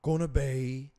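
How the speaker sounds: high, loud Pacino shout. Retold or imitated. Doing Al Pacino on the podcast, loud and high. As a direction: belt it like Al Pacino.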